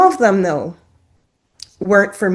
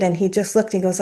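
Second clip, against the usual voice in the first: normal talking voice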